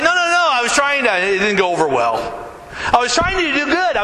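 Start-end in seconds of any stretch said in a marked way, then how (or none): 0.0-1.1 high, fast flustered denial; a kid scrambling to explain, pitched up; quick panicky no no no; flustered protest. Retold or imitated. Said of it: "I was like".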